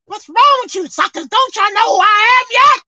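high, loud, scolding disbelief; exasperated Lord. Retold or imitated. Jesus rebuking his frightened disciples, loud exasperated scolding. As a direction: scold them like they should know better. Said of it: "He must be looked at them like".

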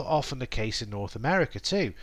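ordinary delivery

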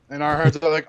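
ordinary talk

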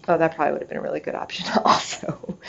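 breathy whispered aside with a laugh, fast; quick sheepish whisper, laughing. Retold or imitated. To her daughter, conceding in a laughing whisper. Replying to thirteen-year-old daughter.

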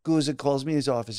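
usual speaking voice

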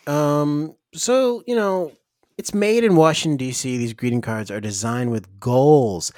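bright commercial pitch; ad read. Acted. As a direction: pitch it like an infomercial. Ad read for greeting cards.

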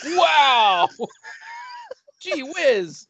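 high, loud hammed-up wow; mock amazement. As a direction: gush like a dopey kid. Mocking a guy's reaction.

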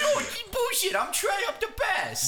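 very high, whiny-boaster send-up; high put-on voice, hammed-up indignation; mocking indignant impression. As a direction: squeal it like a wounded braggart. Mocks friend's boastful indignation.

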